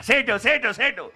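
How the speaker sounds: high, loud repeated outburst; exclaimed catchphrase. Acted. Guest proclaims his own catchphrase to the hosts, exclaiming. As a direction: burst out with it, pitched well up.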